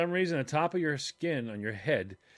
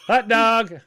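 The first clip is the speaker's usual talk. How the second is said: loud playful shout, higher; mock yell; 9 semitones higher, 10 dB louder than usual. Imitating Christine, yelling "Hot dog" at random. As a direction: holler it out at random. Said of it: "stop yelling".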